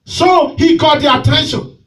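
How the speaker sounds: loud, driving preacher's call; fiery preaching. Real, not acted. Pastor preaching to his church, loud and driving. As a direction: preach it out to the congregation.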